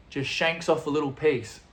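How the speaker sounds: usual speaking voice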